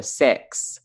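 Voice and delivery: "six" in a Kiwi accent, sounding like "sex"; New Zealand six. Shown as a demonstration. Australian mimicking Kiwi vowels to her cohost: mock Kiwi "six". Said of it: "they would say the number".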